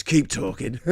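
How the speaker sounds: playful put-on Cockney tough guy; Cockney gangster voice. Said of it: "like, Cockney gangster voice".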